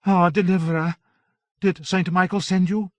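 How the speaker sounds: put-on a devout young warrior calling out; Joan. A narrator's reading. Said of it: "called the small Joan".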